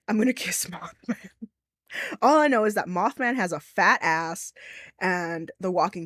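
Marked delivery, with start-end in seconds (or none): 0.1-1.3 breathy secret whisper with a giggle; whispered aside. Real, not acted. Streamer confessing a crush on Mothman, whispered. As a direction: confide it under your breath.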